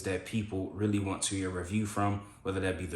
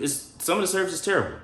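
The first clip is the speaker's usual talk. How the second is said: emphatic; 10 semitones higher, faster than usual. Real, not acted. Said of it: "he was telling the truth".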